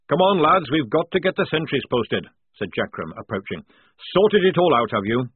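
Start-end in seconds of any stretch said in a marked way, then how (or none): 0.1-2.3 louder, higher barking sergeant; gruff sergeant. A narrator's reading. Jackram calling the squad to post sentries, loud. 4.1-5.3 louder, higher barking sergeant; gruff sergeant. A narrator's reading. Jackram calling the squad to post sentries, loud.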